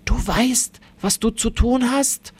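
ordinary delivery, nothing marked

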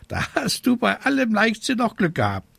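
high, quick bird voice; chiding raven. Acted. Raven concluding the witch was lucky, high chiding. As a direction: lecture in a squawky raven voice.

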